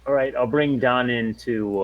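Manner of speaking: ordinary talk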